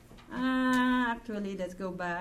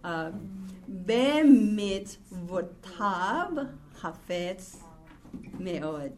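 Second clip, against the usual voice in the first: slow reading; much slower than usual. Shown as a demonstration. Reads Hebrew verse slowly.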